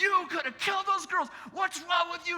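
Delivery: high-pitched, loud angry scolding; imitating a furious uncle's yell; screaming and yelling. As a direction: lay into him at a high shout. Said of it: "he's screaming and yelling".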